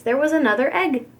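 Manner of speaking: ordinary delivery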